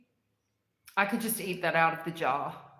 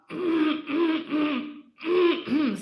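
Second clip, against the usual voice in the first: loud throat clear; 10 semitones higher, 7 dB louder than usual. Real, not acted. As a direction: clear your throat hard. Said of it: "sorry, I keep clearing my throat".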